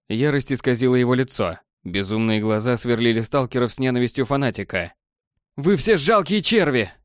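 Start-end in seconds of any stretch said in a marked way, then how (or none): 5.6-6.9 high, slow, scornful cry; acted shout. A narrator's reading. Crazed elf to the stalkers, shouting in rage. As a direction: cry out with fanatic rage, pitched up. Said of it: "закричал он".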